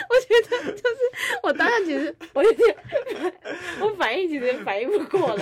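high, laughing through words; can barely get it out for laughing; laughing helplessly. Real, not acted. Tutor recalling the comic book trick, laughing through words.

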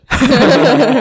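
high, loud burst of laughter. Real, not acted. Laughing about teacher spitting.

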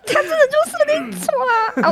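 high exasperated wail; venting about a coworker's mistake. Real, not acted. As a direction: let out the frustration in a high cry.

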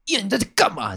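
high-pitched mock stern challenge; 模仿凶巴巴的上司质问; fierce boss. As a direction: imitate a short-tempered boss snapping across the room. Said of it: "哦，很凶".

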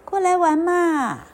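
put-on high, coaxing child's voice; children calling out. A narrator's reading. Children to the duckling, calling out playfully. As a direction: coax someone over to play.

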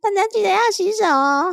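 put-on very high cutesy baby voice; sweet sing-song little girl voice. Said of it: "就是你每一次开场介绍".

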